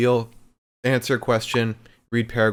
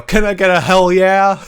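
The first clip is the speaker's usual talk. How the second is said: loud, high wrestler shout; Stone Cold yell; 9 semitones higher, 10 dB louder than usual. Retold or imitated. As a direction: yell it to the crowd. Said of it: "just yell".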